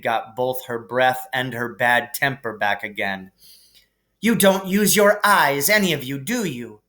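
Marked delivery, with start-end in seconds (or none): 4.2-6.8 snapped. A narrator's reading. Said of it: "she snapped".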